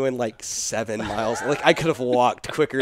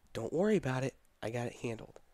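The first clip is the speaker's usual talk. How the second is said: quiet reassurance; 11 dB quieter, faster than usual. Retold or imitated. Recalling what God said in scripture, quietly reassuring. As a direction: gently settle the worry, very softly.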